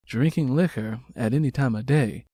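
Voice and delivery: usual speaking voice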